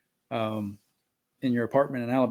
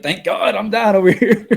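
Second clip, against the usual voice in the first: relieved; 9 semitones higher, 11 dB louder than usual. Retold or imitated. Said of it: "I was like".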